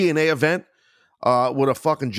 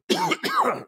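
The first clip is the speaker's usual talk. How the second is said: hard choking cough; coughing fit. Real, not acted. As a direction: cough like something's stuck. Coughing fit mid-sentence.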